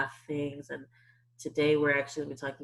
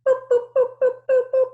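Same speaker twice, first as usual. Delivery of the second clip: squeaky high boops, much louder; high boop sound effect; 11 dB louder, much faster than usual. Shown as a demonstration. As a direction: beep like buttons switching ears on. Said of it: "put your listening ears all the way on".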